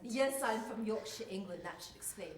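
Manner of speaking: Yorkshire Englishwoman, falling away at the end; English accent. Acted. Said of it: "You're speaking with an English accent".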